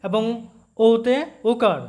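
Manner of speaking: ও-তে ওকার spoken slowly, each part separate; slow careful explanation. Shown as a demonstration. Teacher slowly pointing out the ও sound to students. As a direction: spell it out slowly to make the point. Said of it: "লক্ষ্য করো".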